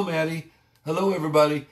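normal talking voice

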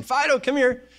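high-pitched summons to a dog; calling a pet. Retold or imitated. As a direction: call the beast over like a house pet. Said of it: "The guy is actually calling the bear".

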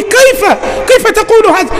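very high, disbelieving; stunned high-pitched "how?"; astonished question. Retold or imitated. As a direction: ask in shock. Prophet astonished.

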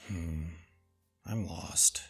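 usual speaking voice

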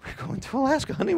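high, light imitation of his wife; voicing the wife. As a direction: play the excited wife announcing the trip. Wife's voice in retold story.

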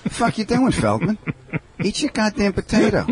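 a hint of a Brooklyn wiseguy barking at Feldman; mock tough guy. Teasing Feldman about his potato, mock wiseguy.

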